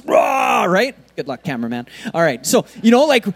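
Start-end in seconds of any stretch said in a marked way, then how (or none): 0.0-0.7 mock war cry. Mock collapse charge.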